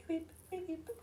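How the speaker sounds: high little bird beeps; imitated bird chirp. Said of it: "Petit oiseau?".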